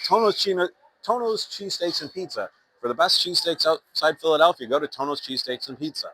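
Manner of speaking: soft, hushed read of a sponsor spot. Acted. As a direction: slip into a quiet commercial voice. Sponsor ad read mid-broadcast.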